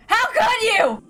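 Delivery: very high, breaking with grief and rage; anguished cry. Acted. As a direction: let the question crack into a cry. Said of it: "obscured by anger and tears".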